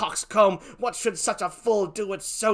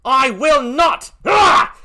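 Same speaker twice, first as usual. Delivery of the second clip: loud high scream as she is stabbed; wife screams as her husband stabs her; shout and scream; 5 semitones higher, 12 dB louder, slower than usual. Acted. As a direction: scream out in pain. Emilia stabbed.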